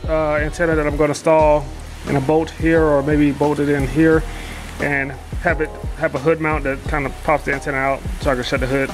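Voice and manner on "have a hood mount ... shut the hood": normal talking voice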